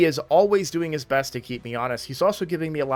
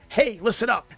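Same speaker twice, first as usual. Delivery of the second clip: higher-pitched, mildly raised attention call; mock yell; 8 semitones higher than usual. Creator mimicking his pre-recorded ad to viewers, mock yelling. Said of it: "goes from whispering to yelling".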